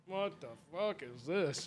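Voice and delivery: slow, soft, understated bewilderment; muttered disbelief. Retold or imitated. Girlfriend's dad puzzled by a hug, muttered disbelief. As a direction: say it low-key, stretched out, under the breath. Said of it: "And he's like".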